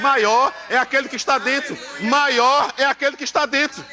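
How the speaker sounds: building revival cadence; preaching. Acted. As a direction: drive the verse home to the church. Preaching the verse.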